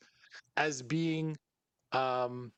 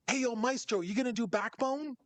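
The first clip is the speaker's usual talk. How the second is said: high eager fan shout-out, higher pitch; a fan hollering across the room, pitched up; fan calling out; 8 semitones higher than usual. Retold or imitated. Fan asks the rapper about his set, eager and high.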